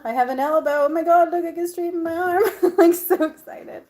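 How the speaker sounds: high, loud giddy gushing; thrilled. Real, not acted. Showing viewers her freed arm, thrilled and laughing. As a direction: burst with joy at the free arm.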